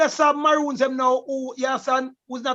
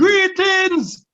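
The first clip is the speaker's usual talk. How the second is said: Greetings shouted high and loud; booming Greetings after a hushed one; 6 semitones higher, 6 dB louder than usual. Shown as a demonstration. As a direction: call out Greetings to the whole room. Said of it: "we the Patois man say greetings first now".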